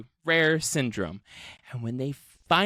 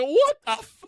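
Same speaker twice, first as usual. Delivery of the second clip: high, louder shocked half-swear; shocked aunt; 5 dB louder than usual. Retold or imitated. Aunt hearing the corpse speak, shocked high cry. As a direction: cut off mid-curse in horror. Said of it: "And she's like".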